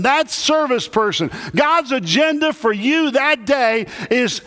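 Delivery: preaching at full force. Acted. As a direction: lift it up and drive it home to the room.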